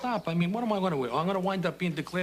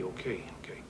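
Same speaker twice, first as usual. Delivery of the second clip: whispered okay; 7 semitones lower, 9 dB quieter than usual. Acted. Backing down after the outburst.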